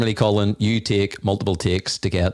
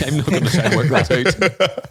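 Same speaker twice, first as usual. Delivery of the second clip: higher-pitched, laughing through the words; 8 semitones higher than usual. Real, not acted. Host surrendering to the guest's confusing fractions, laughing. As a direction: give up with a real laugh.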